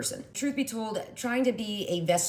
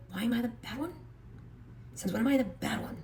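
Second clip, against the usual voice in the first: acted soft, higher-pitched protest; hurt protest; 5 semitones higher, 7 dB quieter than usual. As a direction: play the wrongly blamed partner, voice raised in pitch but kept soft. Acted quarrel, accused partner protests.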